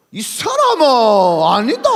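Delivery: loud, drawn-out Gyeongsang dialect denial; Gyeongsang accent. Acted. Peter denying in Gyeongsang dialect, loud and slow. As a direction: stretch it out in thick Gyeongsang dialect. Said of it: "억양이 다르대".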